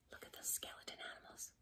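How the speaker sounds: hushed quick whisper. Real, not acted. Narrator whispers to the child about the pictures. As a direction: whisper it like a secret.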